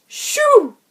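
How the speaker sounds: high, loud shoo; shout. Acted. Neighbour chasing the cat from her kitchen, shouting. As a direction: chase the cat off. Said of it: "Miss Horn shouted".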